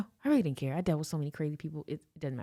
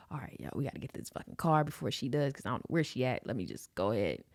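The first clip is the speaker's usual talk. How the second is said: low, softer muttering on the move; hurried self-talk; 5 semitones lower, 6 dB quieter than usual. Retold or imitated. Man jogging to his car, low hurried muttering. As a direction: mutter it to yourself while jogging.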